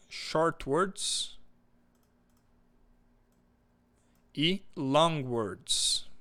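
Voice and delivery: very slow, each word drawn out; slow, deliberate reading of the labels; slow spelled-out labels. Shown as a demonstration. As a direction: read the labels slowly for the class. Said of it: "teacher reading slide".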